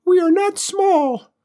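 very high, slow, talking down; patronizing. Acted. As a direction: patronize him in a squeaky alien voice. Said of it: "in a patronizing voice".

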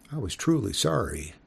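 ordinary delivery

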